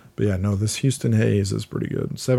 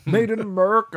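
high mock Chinese accent; 12 semitones higher than usual. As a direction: squeak it out in a put-on Chinese accent. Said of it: "written in a Chinese accent".

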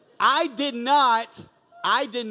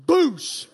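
mock body-slam noise; 7 semitones higher, 7 dB louder than usual. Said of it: "And she just says".

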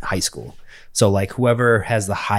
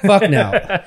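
much higher, rising, emphatic; emphatic repeat; 9 semitones higher, 3 dB louder than usual. Real, not acted. Asked if his parents knew, emphatic high denial. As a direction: blurt it out high and incredulous.

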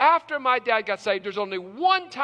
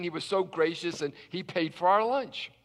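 usual speaking voice

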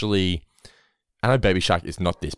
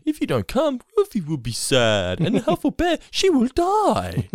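put-on high, slow children's singer; mock sweet-talking kids' entertainer, drawn out; hammed-up high plea; 13 semitones higher, slower than usual. Imitating [name]'s ticket threat to children.

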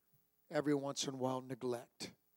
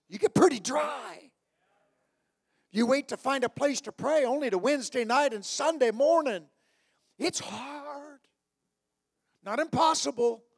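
loud, high, shouting preacher; booming motivational speech; 7 semitones higher, 9 dB louder than usual. Acted. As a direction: climb to a shouted peak.

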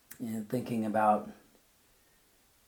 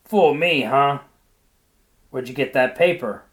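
loud teasing; 4 semitones higher, 13 dB louder than usual. A narrator's reading. Husband teasing loudly.

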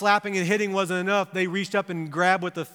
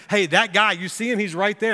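raised-pitch, punchy pointing-out; girl alerting others; 4 semitones higher, 5 dB louder than usual. Retold or imitated. Girl tells everybody around about Peter, accusing. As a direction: act out a girl tipping off bystanders. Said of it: "she starts telling everybody around him".

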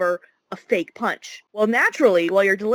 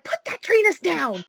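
teacher voice; 8 semitones higher than usual. Retold or imitated. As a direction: snap it like a strict teacher. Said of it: "my teacher voice comes out".

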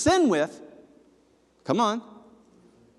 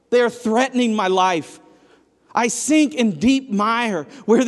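louder, pleading scripture read; impassioned psalm reading; 3 semitones higher, 7 dB louder than usual. Acted. Preacher reads King David's cry for rescue, pleading and loud. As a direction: read it as a drowning man's plea.

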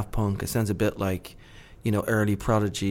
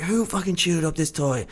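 higher-pitched scolding of a dog; mock scolding; 6 semitones higher than usual. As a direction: pretend to tell off the dog. Scolding dogs in retold scene.

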